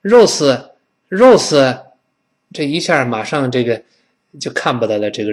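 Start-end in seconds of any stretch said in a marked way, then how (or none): 0.0-1.8 Rose as 肉丝 with a Chinese r sound, louder; wrong r, mock. Shown as a demonstration. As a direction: imitate students saying 肉丝. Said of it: "管它叫肉丝".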